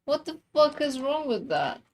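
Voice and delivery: ordinary talk, nothing marked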